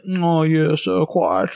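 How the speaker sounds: put-on British cartoon toff; long-chin cartoon guy. Imitating the long-chin guy from Family Guy, mock British. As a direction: imitate a drawn-out cartoon Englishman. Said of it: "long chin guy, I think, in Family Guy".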